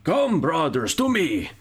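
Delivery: high, lilting Scandinavian barbarian war call; rally the warriors in a broad Scandinavian accent; hammed-up singsong Scandinavian accent. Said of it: "just Scandinavian".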